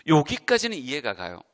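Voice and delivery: ordinary talk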